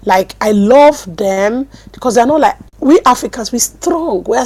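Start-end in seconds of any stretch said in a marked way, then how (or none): none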